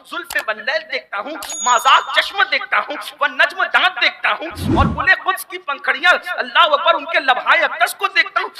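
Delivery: loud, high, ecstatic preaching with a laugh of joy; rising, rapturous poetic praise; fervent devotional recital. Acted.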